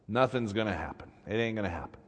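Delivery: low, soft resignation; defeated. Retold or imitated. Priests give up before evening, low and defeated. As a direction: give up, voice dropping right down.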